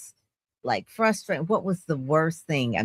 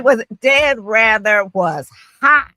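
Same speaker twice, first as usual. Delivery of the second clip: loud, slow, raised-pitch exclamation; emphatic retelling; 8 semitones higher, 10 dB louder, slower than usual. Retold or imitated. Host recounts a newsman's on-air anger, loud and emphatic. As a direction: draw it out loud for comic emphasis.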